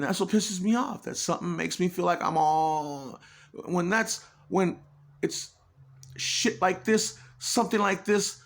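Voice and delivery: frustrated. Real, not acted. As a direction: let the annoyance push the voice up. Frustrated rant, raised voice.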